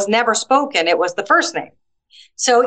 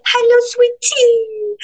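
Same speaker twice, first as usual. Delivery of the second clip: much higher, slow cooing hello; sweet teacher greeting; 13 semitones higher, slower than usual. Retold or imitated. Teacher greeting a small child, much higher and slow sing-song. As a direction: coo it like greeting a toddler. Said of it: "they'll go".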